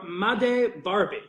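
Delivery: slow name reading. Shown as a demonstration. Voice actor reading a fan's name aloud, slowly. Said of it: "listen to this".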